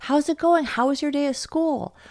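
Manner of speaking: upbeat parent looking up from the phone; cheerful attentive parent. Shown as a demonstration. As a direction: turn to your child and greet them gladly. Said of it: "what you do is you pivot to them".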